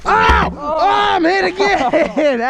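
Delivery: high yelp, then slow cry; hit hard crying out. Real, not acted. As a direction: yell out as you're hit. Pellet hits him.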